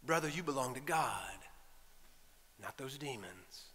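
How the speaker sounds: quiet, low, unhurried comfort; calm reassurance. Acted. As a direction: softly reassure a troubled man, voice dropping away. Preacher voices Jesus calming the man.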